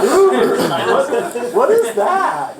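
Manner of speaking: very high, loud, laughing disbelief; mock incredulous outburst. Manager scoffing at salesman driving Uber, loud laughing incredulity. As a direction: shriek it in amused disbelief, dragging the last words.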